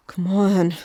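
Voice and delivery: strained push through clenched teeth; forcing it out while hauling a weight; through gritted teeth. A narrator's reading. Narrator dragging the body, straining through gritted teeth. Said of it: "we said through gritted teeth".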